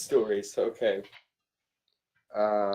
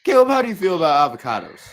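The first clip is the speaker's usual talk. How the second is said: excited; 6 semitones higher, 9 dB louder, faster than usual. Acted. Mock interviewer question.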